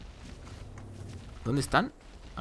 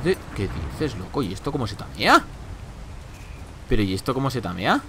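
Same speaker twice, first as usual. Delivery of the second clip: ordinary talk, nothing marked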